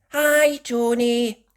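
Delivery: put-on little girl calling to a friend. A narrator's reading. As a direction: call a friend's name, a bit shy. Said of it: "her voice came out not quite loud enough".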